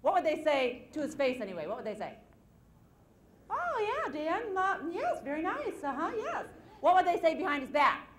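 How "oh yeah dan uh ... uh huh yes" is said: high, sugary mock compliments; fake polite. Coworkers flatter Dan to his face, high and falsely sweet. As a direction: pretend to admire the wig.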